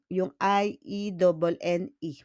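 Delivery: usual speaking voice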